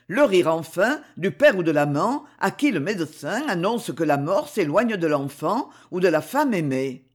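ordinary delivery, nothing marked

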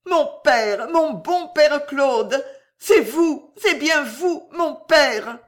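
put-on a girl finding her father again; joyful tearful cry. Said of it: "Violetta souriait et répétait".

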